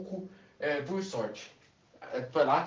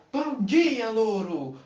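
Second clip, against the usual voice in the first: high bright call to the parrot; cheery greeting; 6 semitones higher, 4 dB louder, much slower than usual. Acted. Woman greets her new parrot, high and cheery. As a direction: sing out good morning.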